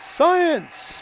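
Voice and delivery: high triumphant cry of Science; excited shout. Real, not acted. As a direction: burst out high and loud. Triumphant exclamation, high and loud.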